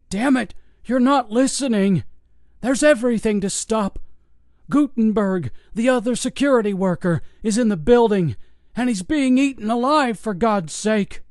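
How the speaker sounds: put-on high, desperate old man shouting; frantic old guard. Old guard pleading with investigators who won't listen, desperate. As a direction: plead hard, near panic, pitched high.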